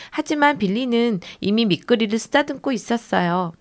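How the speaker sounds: ordinary delivery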